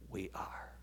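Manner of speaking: breathy hush, almost no voice; whispered. Real, not acted. Preacher to congregation, whispering. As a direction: sink into a whisper on the payoff. Preacher hushes the key phrase.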